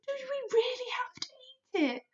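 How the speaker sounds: put-on high, hushed girl's voice; little girl whisper. Sophie voice whisper.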